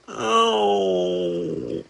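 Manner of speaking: long high wail of complaint; whiny groan. Real, not acted. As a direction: let out a high, drawn-out moan. Groans over the bug bites.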